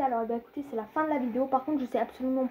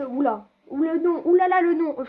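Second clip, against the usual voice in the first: startled oulala, rising higher and louder; surprised reaction; 4 semitones higher, 5 dB louder than usual. Real, not acted. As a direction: react aloud, taken aback by a name. Réagit au nom découvert. (surpris, de plus en plus fort)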